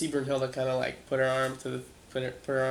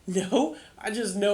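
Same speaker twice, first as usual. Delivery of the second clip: angry townsman; 6 semitones higher, slower than usual. Acted. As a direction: shout him down in disbelief. Townsman answers the player.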